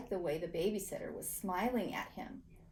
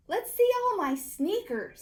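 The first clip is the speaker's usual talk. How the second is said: eager show-off; 10 semitones higher, 6 dB louder than usual. A narrator's reading. Paxton eager offer.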